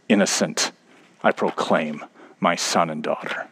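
low, soft, grave verdict; a judge's quiet final pronouncement; God proclaiming. Retold or imitated. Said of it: "and says".

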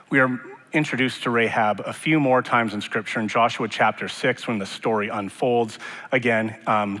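usual speaking voice

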